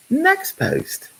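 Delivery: very high, prim British announcement; mock British accent. [name] moving on to his next post, in a mock British accent. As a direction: chirp it like a posh Brit. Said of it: "She just kept going".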